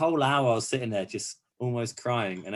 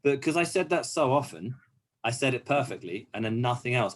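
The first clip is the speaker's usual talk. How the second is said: ordinary talk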